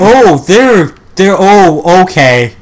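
loud, high, flustered alarm; startled fear. Real, not acted. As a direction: stammer it as they close in. Mannequins surround them.

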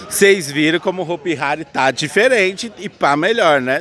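high, loud, hyped sign-off; upbeat promo outro. Acted. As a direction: sell it like an ad read.

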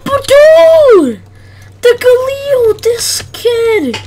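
loud disbelieving gamer; excited shout. Real, not acted.

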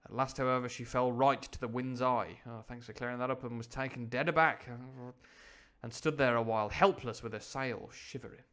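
plain reading voice

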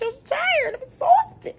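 very high whiny complaint; whiny exhausted. Retold or imitated. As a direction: whine it like a tired kid.

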